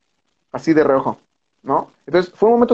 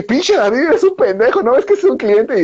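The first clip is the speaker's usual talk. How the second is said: mock scolding; 9 semitones higher than usual. [name] scolding David after goo hit.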